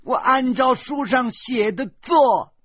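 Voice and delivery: very high, loud, drawn-out shrieked accusation; stern furious scolding. Acted. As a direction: scream each phrase slowly, stretching the last word. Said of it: "严明厉声骂道". (厉声拖长音高声怒骂)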